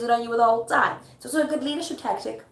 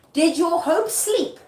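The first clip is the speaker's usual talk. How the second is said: high, loud, slow challenge; dramatic incredulous questioning; taunting question; 8 semitones higher, 5 dB louder, slower than usual. Acted. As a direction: draw it out in a raised pitch. Paraphrases Lady Macbeth's taunt.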